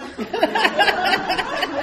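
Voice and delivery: high burst of laughter; loud laugh. Real, not acted. Laughing at own story.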